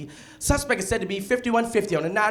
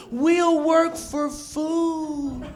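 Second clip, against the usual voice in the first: slow pitiful beggar voice; 5 semitones higher, much slower than usual. Retold or imitated. Homeless sign read in voice.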